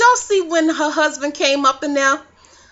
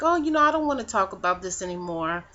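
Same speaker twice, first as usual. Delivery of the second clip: collected quiet; 5 semitones lower, 7 dB quieter, faster than usual. Retold or imitated. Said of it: "then be like".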